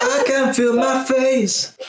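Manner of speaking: high, slow, exaggerated echo; mock-dramatic echo. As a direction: ham it up, copying her. Echoing partner, mock-dramatic.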